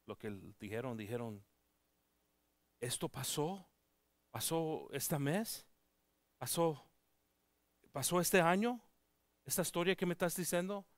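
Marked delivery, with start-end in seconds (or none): 2.8-10.8 climbing disbelief, the last one rushed; amazed questions. Retold or imitated. Village master to the pastor, astonished and rising. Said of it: "Estaba sorprendido".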